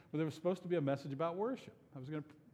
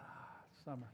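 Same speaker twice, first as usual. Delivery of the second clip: dreamy sigh; 5 semitones lower, 15 dB quieter than usual. Pretend. Imagining a pleasant summer day.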